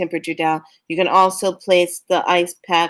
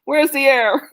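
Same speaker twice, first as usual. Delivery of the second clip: mock panic; 7 semitones higher than usual. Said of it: "They stand there going".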